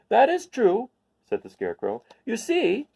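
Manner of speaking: bright scarecrow agreement; high scarecrow voice; agreeing scarecrow. Acted.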